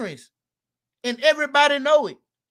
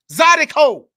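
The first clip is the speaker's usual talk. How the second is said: Zydeco called out much louder; proud shout; 5 semitones higher, 11 dB louder than usual. Real, not acted. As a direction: announce it like a proud punchline. Proudly naming his music.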